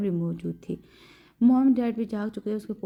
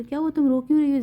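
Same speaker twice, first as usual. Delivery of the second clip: raised, alarmed concern; worried; 5 semitones higher, 6 dB louder than usual. A narrator's reading. Zaid asks crying Jamal what happened, worried. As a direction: ask her urgently what is wrong. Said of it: "زید نے پریشانی سے کہا".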